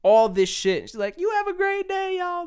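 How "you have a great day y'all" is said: very high, sing-song fake-friendly farewell; mock sweet worker. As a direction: parody the worker's syrupy goodbye. Worker's sweet goodbye voiced mockingly.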